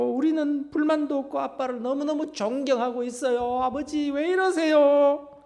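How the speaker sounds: pleading family, imitated with mild emotion; acted tearful plea. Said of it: "막 엉겨붙어서 울고 난리를 치는데".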